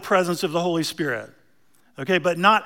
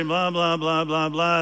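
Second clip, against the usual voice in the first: droning blah-blah; 3 semitones lower than usual. Retold or imitated. Drones the priest's words as empty routine.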